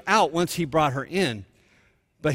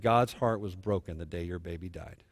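low, hushed, compassionate retelling; tender consolation; 9 semitones lower, 7 dB quieter than usual. Retold or imitated. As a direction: softly share a father's sorrow. Consoling a bereaved father, retold.

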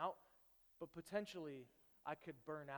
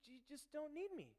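gentle honesty; 7 semitones higher, 6 dB quieter than usual. Retold or imitated. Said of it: "He's like".

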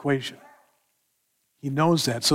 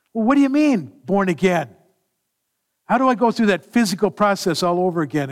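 higher, louder baffled question; bewildered; 4 semitones higher, 4 dB louder than usual. Acted. Preacher voicing Nicodemus, baffled and asking. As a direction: ask it like a man who can't fathom it.

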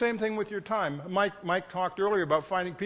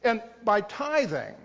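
ordinary delivery

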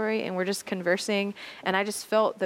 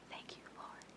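breathy whispered thanks; whispered prayer; 27 dB quieter than usual. Retold or imitated. She silently thanks God, whispering. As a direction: whisper it up to heaven.

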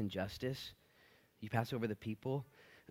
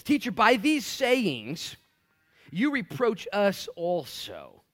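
slow, higher, louder indignant protest; a slow, hammed-up whine of offense; offended lawyer; 7 semitones higher, 7 dB louder, much slower than usual. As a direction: drag it out like a wounded lawyer. Lawyer objects to Jesus.

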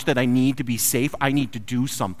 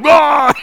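frustrated shout; 13 semitones higher, 14 dB louder than usual. Retold or imitated. Brothers yelling in storm.

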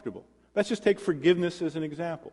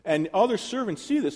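ordinary delivery

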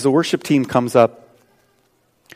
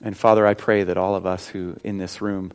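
leading a prayer; 3 dB louder than usual. Acted. Pastor praying with the congregation, earnest prayer. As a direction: pray aloud for the whole room.

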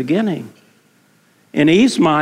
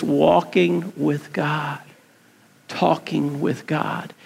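much softer and slow, almost breathing it; hushed wonder; 3 semitones lower, 6 dB quieter, slower than usual. Real, not acted. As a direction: let the question fall to a hush. Preacher savours Eden image.

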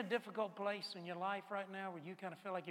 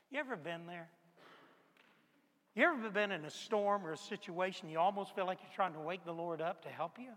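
ordinary delivery

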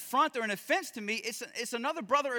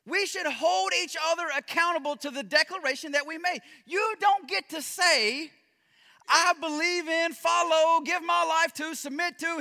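impassioned preaching; 4 semitones higher, 4 dB louder than usual. Acted. Preaching builds to full force.

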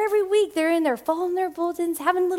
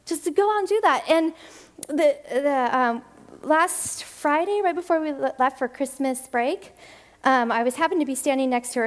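normal talking voice

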